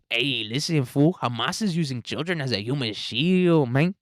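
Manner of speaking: lilting mock Mexican accent, swooping up and down; caricatured sing-song Mexican accent. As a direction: do a hammed-up Mexican accent, lifting the last word. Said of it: "That's what Sheinbaum says to us".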